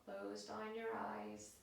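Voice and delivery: soft, unhurried yoga relaxation voice; guided meditation. Acted. Yoga teacher settling class into a held pose, soft and calm. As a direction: guide them into stillness, hushed and spaced out.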